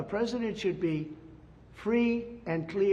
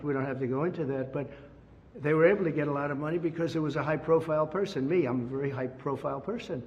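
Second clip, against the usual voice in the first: normal talking voice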